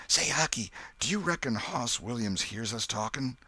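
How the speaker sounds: high, uneasy Tom; nervous boy keeping his voice down; whispered question. A narrator's reading. Tom asks Huck about the dead man, nervous. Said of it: "Then Tom whispered".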